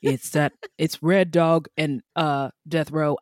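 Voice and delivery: deep dramatic voice. Retold or imitated. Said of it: "I was like".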